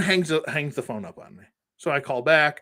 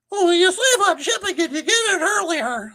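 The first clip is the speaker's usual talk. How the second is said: much higher, louder, laughing mock pitch; mocking sales pitch; 5 dB louder than usual. Mocking the Pulse membership pitch to viewers, high laughing parody.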